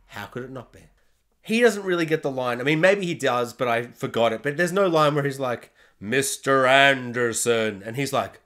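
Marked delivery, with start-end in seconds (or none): 6.0-7.8 drawn-out, low movie-villain intonation; slow menacing villain. Retold or imitated. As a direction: savour each syllable like a cold agent. Quoting the villain's famous line.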